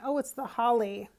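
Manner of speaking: ordinary talk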